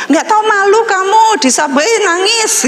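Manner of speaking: high scolding voice of a father; Korean father scolding. Retold or imitated. Korean foster father scolding his crying daughter, high chiding voice. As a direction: scold her like a worried dad. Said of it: "ayah Korea saya bilang".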